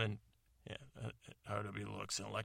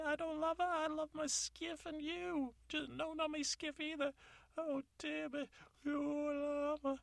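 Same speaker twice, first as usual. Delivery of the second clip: very high, squeaky panicked denial; panicked falsetto. Acted.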